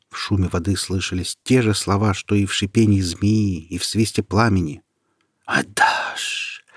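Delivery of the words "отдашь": whispered rushing water. Acted. The flood demanding Peter's heart, whispered rush. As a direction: breathe it like a torrent.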